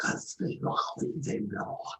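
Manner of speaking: eerie, voiceless hissed whisper; growly, creepy whispering. Acted. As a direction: whisper creepily, as if casting at someone. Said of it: "like, whisper at her".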